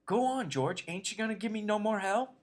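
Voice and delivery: high, loud and eager. A narrator's reading. Lenny eagerly begs George to keep scolding, high and loud. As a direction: urge him on eagerly. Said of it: "Lenny looked eagerly at him".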